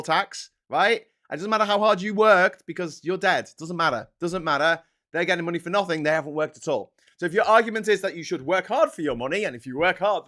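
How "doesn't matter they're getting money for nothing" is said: ordinary talk, nothing marked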